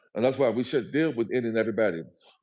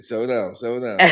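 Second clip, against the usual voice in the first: calming laugh; 7 dB louder than usual. Pretend. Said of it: "And I'm gonna say".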